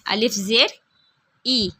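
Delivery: slow, drawn-out chanted letter drill; teacher's call-and-repeat reading of Arabic letters; Qaida drill recitation. Shown as a demonstration. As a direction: recite each syllable slowly for pupils to repeat.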